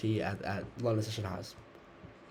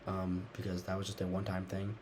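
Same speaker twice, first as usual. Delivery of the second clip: ordinary talk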